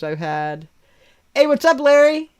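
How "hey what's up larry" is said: high, loud happy hello; cheery greeting. Real, not acted. Greeting the cat Larry with a high loud hello. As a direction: greet him brightly.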